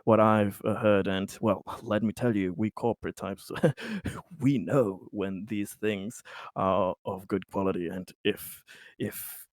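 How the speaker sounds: soft, low, halting businessman voice; hesitant corporate type. Acted. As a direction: feel out a discreet business pitch, haltingly. Plays Conrad, a corporate buyer.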